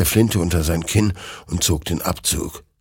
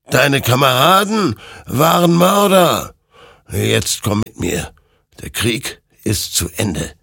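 high, measured otherworldly tone; spirit voice, calm; 7 semitones higher, 4 dB louder than usual. A narrator's reading. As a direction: beckon him gently but firmly. Creature answers Hector.

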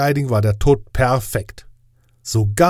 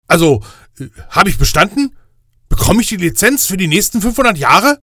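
higher, louder nervous voice; anxious Death; 8 semitones higher, 6 dB louder, faster than usual. Acted. Death anxiously asking the inspector angel, higher and louder. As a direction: blurt it out, eager and nervous.

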